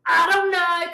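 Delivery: high, loud exasperated wail; frazzled outburst. Retold or imitated. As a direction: wail it at a friend, overwhelmed. Retells her frazzled reply to friend.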